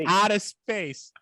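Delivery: slow, drawn-out Boston "Out of space"; Boston accent. Shown as a demonstration. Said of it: "my Boston accent almost kicked in there".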